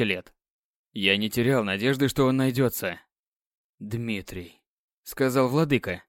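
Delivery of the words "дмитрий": тихо. Acted. Люциан понимает, кто предатель.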